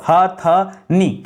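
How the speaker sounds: हथनी stretched out very slowly, syllable by syllable; slow careful dictation. Shown as a demonstration. Teacher to students, spelling out the correct word slowly. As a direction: drag out हथनी so every sound is clear. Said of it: "क्या होता है दोस्तों".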